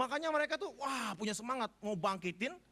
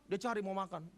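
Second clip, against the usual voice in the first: ordinary talk, nothing marked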